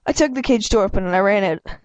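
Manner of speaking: ordinary delivery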